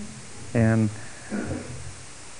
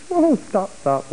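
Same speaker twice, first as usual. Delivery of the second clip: sudden self halt; 10 semitones higher, slower than usual. Retold or imitated. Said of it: "I go".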